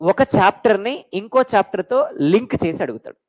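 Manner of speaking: ordinary delivery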